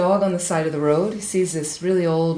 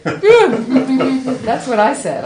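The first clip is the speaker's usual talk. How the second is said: high, loud, slow words through a laugh; laughing quote; 7 semitones higher, 10 dB louder, much slower than usual. Retold or imitated. Friend confirming her idea, laughing. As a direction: laugh it out, delighted. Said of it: "She's like".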